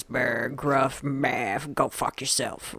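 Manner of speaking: low, slow mock grumble; gruff roommate. Describing roommate to co-hosts, mock gruff voice. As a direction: imitate a rough-around-the-edges grouch. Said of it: "kind of rough around the edges".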